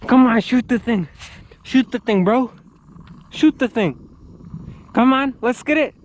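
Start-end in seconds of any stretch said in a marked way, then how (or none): none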